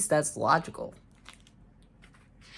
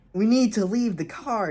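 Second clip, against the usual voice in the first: high, raised read-aloud yell; 7 semitones higher, 5 dB louder than usual. A narrator's reading. Susan telling family to leave the car, yelling. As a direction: yell it as a character in a story. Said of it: "yelled Susan".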